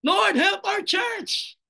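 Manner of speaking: high, very slow loud plea; pleading shout. Acted. Preacher modeling a church's cry to the Lord. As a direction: stretch out a desperate cry for help. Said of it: "Dapat ganyan ang iyak natin".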